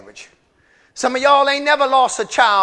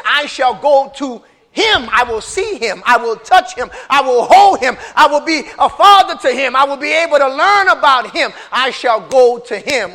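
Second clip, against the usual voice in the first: starts firm and swells louder line by line; building declaration; 6 dB louder than usual. Acted. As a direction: build David's vow into a cry, louder each line. Preacher voices David's vow, building.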